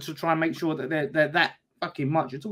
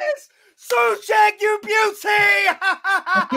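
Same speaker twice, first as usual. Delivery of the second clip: high, slow drawn-out yell of praise; joyful shout; much slower than usual. Real, not acted. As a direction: bellow his name in delight. Celebrating goalscorer.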